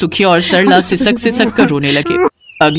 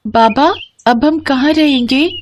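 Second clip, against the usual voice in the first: put-on high child's voice, questioning; worried little daughter; 6 semitones higher than usual. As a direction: ask father anxiously, pitched up. Homeless daughter questions father. (परेशान बच्ची की ऊँची आवाज़)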